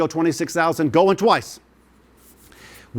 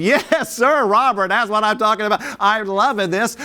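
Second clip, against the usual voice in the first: laughing excited cheer; 5 semitones higher than usual. Real, not acted. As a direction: crow with joy over the bid. Laughing as a bid lands.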